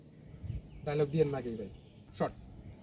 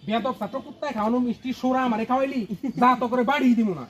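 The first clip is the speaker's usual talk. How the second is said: loud, raised-pitch scolding; angry; 5 semitones higher, 8 dB louder than usual. Shown as a demonstration. Director models the teacher's rebuke for the actor, angry. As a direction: demonstrate an outraged teacher telling a student off. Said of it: "রাগ করেই থাকেন ভাই".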